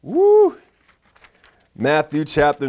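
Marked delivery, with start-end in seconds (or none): 0.0-0.6 high, loud preacher's whoop; excited whoop. Real, not acted. Preacher overcome before reading the scripture, excited whoop. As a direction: let out a sharp, high woo.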